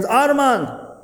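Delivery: high calling shout into the rubble; desperate father's call; screamed name. Acted. Father finds son, screams name.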